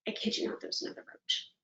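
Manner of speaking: hushed dramatic whisper. Acted. Whispering to the audience about another roach. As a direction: whisper it like a secret.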